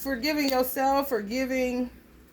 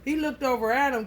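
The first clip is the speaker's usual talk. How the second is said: usual speaking voice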